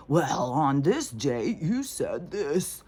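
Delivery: slow, drawn-out interrogating voice; pointed mock cross-examination, slowed right down; mock lawyer grilling. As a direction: lay out each word like a smug lawyer. Mocking lawyers quizzing a witness.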